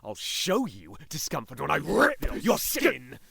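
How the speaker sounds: loud, high-pitched snarl of a threat; angry threat. Acted. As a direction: shout it, rising into a furious menace. Threatens to rip skin.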